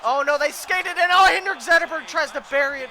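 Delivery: high, loud panic; panicked play-by-play. Real, not acted. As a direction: shout the danger as it unfolds. Opponent attacks the goal.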